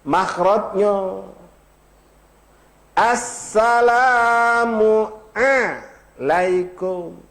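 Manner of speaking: assalamualaikum drawn out very slowly, every letter clear; careful makhraj. Shown as a demonstration. Ustaz showing the congregation the right makhraj, very slowly. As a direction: stretch out assalamualaikum syllable by syllable. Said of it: "pelapasannya, Pak, jangan salah".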